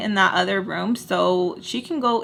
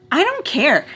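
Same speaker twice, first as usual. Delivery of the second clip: higher, defiant brush-off; defiant retort; 7 semitones higher than usual. Retold or imitated. Pulling away from Sam's grab, defiant retort. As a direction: throw it back in a raised pitch. Retold defiant snap back at Sam.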